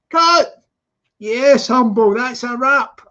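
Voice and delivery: loud cheerful cut; director calling wrap. Acted. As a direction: announce the wrap to the set.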